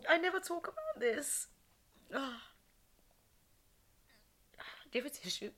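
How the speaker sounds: real tears, barely holding it together; real crying. Real, not acted. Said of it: "why am I crying".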